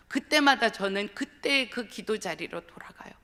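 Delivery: ordinary talk, nothing marked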